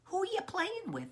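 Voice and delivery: high, singsong question; curious mother. Acted. Snake's mother asks her child the same, high and curious. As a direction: ask up high, as to a little one.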